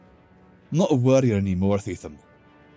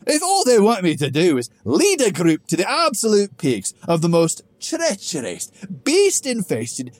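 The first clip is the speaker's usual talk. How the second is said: drip sarcasm at the absurd request; sarcastic; 10 dB louder than usual. Acted. Grog mocking Theethum's mountain plan, loud sarcastic disbelief. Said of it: "I'm sensing a little sarcasm".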